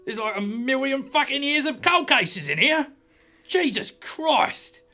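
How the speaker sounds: high, loud, appalled sergeant; shocked outburst. Acted. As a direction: exclaim it as if nearly having a heart attack, drawn out. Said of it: "just nearly has a heart attack".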